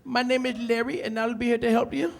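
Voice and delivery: mock customer-service greeting with a ring; call center operator. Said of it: "You call up there, it's like".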